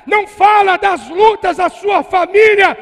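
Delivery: loud, high, slow preacher shout; preaching shout. Real, not acted. Preacher warning the church about family gossip, shouting. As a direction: thunder the warning at the congregation.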